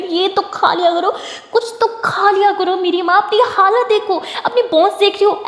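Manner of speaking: high-pitched pleading scold; worked-up, despairing reproach; exasperated nagging. Acted. Exasperated nagging about not eating.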